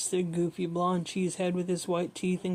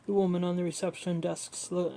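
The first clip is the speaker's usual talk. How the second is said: ordinary delivery, nothing marked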